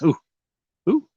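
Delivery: ordinary delivery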